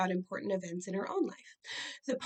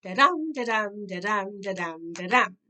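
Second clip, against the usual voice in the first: da-dum da-dum with the dum hit hard each time; heartbeat rhythm; 7 dB louder than usual. Shown as a demonstration. For students, tapping out iambic pentameter like a heartbeat. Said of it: "kind of feels like a heartbeat, like this".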